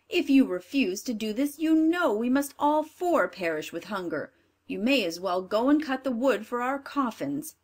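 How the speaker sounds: bossy cruel stepmother; wicked stepmother. Acted. Stepmother scolds husband.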